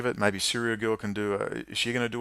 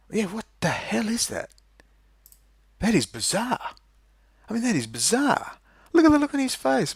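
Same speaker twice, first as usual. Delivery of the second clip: high-pitched disbelief, voice jumping way up; incredulous; 12 semitones higher than usual. Real, not acted. Staring at the Trump clip, high incredulous amazement. Reacting to bizarre Trump footage.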